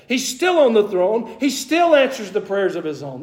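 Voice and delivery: high-pitched, impassioned declaration to God; confident proclamation. Acted. David to God after losing his throne, confident proclamation. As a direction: proclaim it with conviction, pitch lifted throughout. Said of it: "This is David's proclamation".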